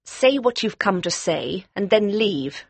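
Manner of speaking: high, hard woman's voice, cold; cold, aggressive. A narrator's reading. Hard-looking woman to her unwelcome visitor, cold and aggressive. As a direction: order the visitor out coldly and deliberately. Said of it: "in a cold, aggressive voice".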